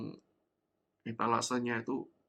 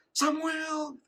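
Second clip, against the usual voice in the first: calling out; 14 semitones higher than usual. Retold or imitated. Mother calling name in crowd.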